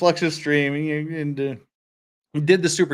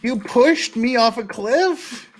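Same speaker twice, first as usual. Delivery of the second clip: much higher, slow, louder outraged question; shocked disbelief; 9 semitones higher, 5 dB louder, much slower than usual. Real, not acted. Streamer reacting to the dog being pushed off a cliff, high slow shock. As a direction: drag it out in mock horror. Learns the game dog was killed.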